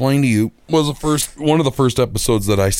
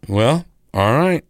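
resigned; 2 semitones higher, slower than usual. Retold or imitated. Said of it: "I'm like".

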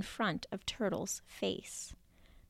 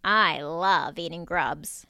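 hearty bear; 4 semitones lower, 6 dB louder than usual. Acted. Big Bear speaks.

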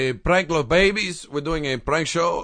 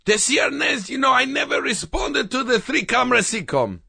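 indignant shout; 8 semitones higher than usual. Acted. Indignant outburst at sitcom creator.

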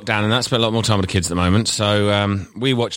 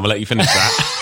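ordinary talk, nothing marked

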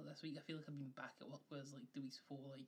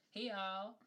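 bright louder greeting; louder hey all; 3 semitones higher, 7 dB louder than usual. Shown as a demonstration. Showing co-host how to say the new opening, louder. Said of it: "There you go".

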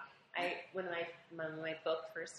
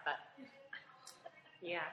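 ordinary talk

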